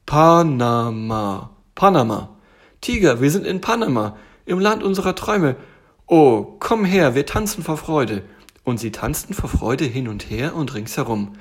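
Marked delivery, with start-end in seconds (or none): 0.1-1.5 PANAMA loud and very slow, each syllable drawn out. Acted. Bear spells out Panama for tiger, drawn-out syllables. 2.8-8.2 high, loud excited exclaiming; overjoyed. Acted. Bear tells tiger they reached Panama, overjoyed.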